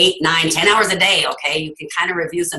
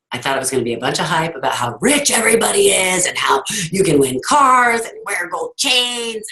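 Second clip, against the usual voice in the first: mocking the hype; 5 semitones higher than usual.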